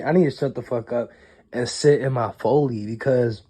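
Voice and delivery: ordinary talk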